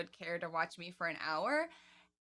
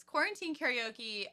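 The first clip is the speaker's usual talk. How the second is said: normal talking voice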